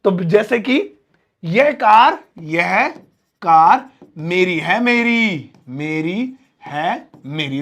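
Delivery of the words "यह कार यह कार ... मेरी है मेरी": "मेरी" pressed hard each time, slow and fading at the end; forceful insistence. Shown as a demonstration. Teacher forcefully claiming the car to show students emphasis. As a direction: insist slowly, almost threatening. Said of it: "जरा ज्यादा ही force कर दिया ना".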